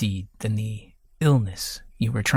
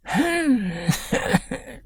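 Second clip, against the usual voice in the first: high, near-hysterical wheezing laugh; 12 semitones higher than usual. A narrator's reading. Sick old husband laughs hysterically at the question. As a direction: laugh on the edge of madness. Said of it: "His laughter sounded nearly hysterical".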